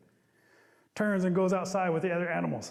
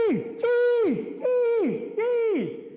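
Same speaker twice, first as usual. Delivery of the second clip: high loud yay cheers; joyful cheer; 7 dB louder, much slower than usual. Acted. All the animals celebrating, high joyful cheer.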